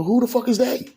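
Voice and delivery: high-pitched indignant demand; acting out angrily confronting a rumour; heated, pissed. Narrator demanding to know who accused him, heated. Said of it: "heated. I'm pissed".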